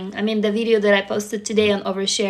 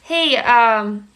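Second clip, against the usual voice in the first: ordinary talk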